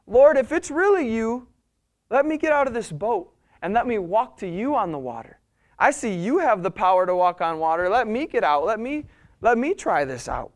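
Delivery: Peter piping up. Acted. Peter asking the Lord to let him walk on water, piping up eagerly. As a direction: pipe up with an excited plea, swinging the pitch. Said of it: "who do we have piping up".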